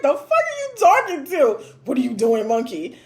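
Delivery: offended mock comeback; mock angry retort. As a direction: bite back, offended. Imagined angry reply quoting text.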